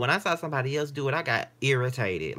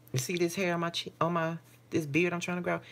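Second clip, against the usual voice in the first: ordinary delivery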